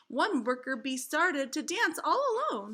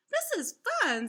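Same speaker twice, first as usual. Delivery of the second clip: put-on high excited child voice; cried; 11 semitones higher than usual. Sally enjoying making honey, high and excited. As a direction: squeal it with delight. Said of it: "Sally cried".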